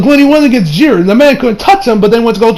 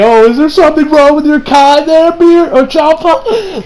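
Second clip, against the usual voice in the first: higher, slow mock-innocent question; sarcastic Beerus; 8 semitones higher, slower than usual. Beerus needling Champa, high and slow with sarcasm. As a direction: ask with a teasing, fake-concerned lilt. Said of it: "sounds like he was kind of being sarcastic".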